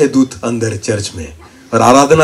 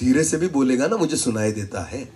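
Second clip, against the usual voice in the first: much softer, gentle fatherly answer; calm the frightened child down; gentle reply; 13 dB quieter than usual. Retold or imitated. God speaking softly to a panicking believer.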